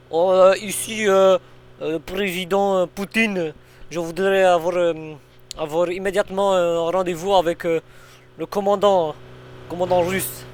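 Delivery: playing a Russian on the phone; Russian accent. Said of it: "prends ton accent russe".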